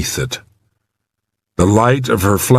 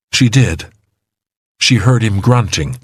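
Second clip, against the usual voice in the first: ordinary delivery, nothing marked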